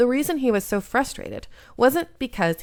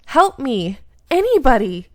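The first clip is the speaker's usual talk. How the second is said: raised, urgent cry for help; calling for help; 4 semitones higher, 7 dB louder than usual. Acted. Carried off, heroine cries out to the empty street for help. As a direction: shout for anyone to hear.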